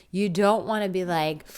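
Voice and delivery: normal talking voice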